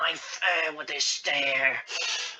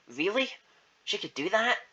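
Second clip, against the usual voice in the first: put-on higher-pitched, questioning young voice; eager orphan's question; 7 semitones higher than usual. Zeke asking about the interview, hopeful. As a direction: ask it in a lifted, hopeful voice.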